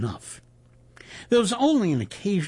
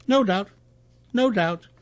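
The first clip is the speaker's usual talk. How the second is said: brightening agreement, higher pitch; brightened; 6 semitones higher, 3 dB louder than usual. A narrator's reading. Reporter perking up at a colleague's remark, brightened. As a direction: perk up with dawning interest. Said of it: "The Reuters man brightened".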